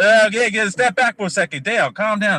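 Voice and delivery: telling him to cool it; exasperated. Real, not acted. Waving off the angry co-host, exasperated.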